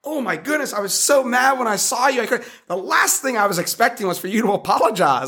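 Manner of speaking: high, overcome voice of a retold quote; imitated tearful reaction. Retelling a man's reaction to an apology, mock tearful and high. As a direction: imitate someone moved to tears, pitch lifted well up. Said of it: "he started crying at the table".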